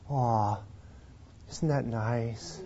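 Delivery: very soft, low and slow; tender cooing. Acted. Preacher savoring a sweet verse, tender and hushed. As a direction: coo it gently.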